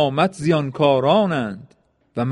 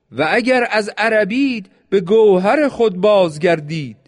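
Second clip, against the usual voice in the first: high, raised call to the enemy; 6 semitones higher than usual. A narrator's reading. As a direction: cry out to them in rebuke. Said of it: "حسین بانگ زد".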